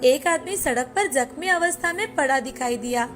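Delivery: ordinary delivery, nothing marked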